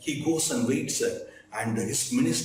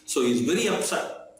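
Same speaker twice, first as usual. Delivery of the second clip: ordinary talk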